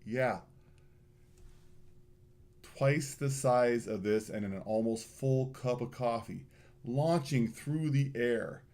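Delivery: ordinary talk, nothing marked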